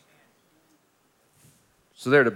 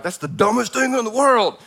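high-pitched, incredulous mock rant; exasperated outburst; 7 semitones higher than usual. Man talking back to his computer, exasperated. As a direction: blurt it out in mock disbelief.

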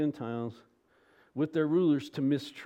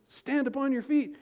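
raised, much higher command; loud voice; 10 semitones higher, 3 dB louder than usual. A narrator's reading. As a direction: call it out as a command, pitched high. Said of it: "he said in a loud voice".

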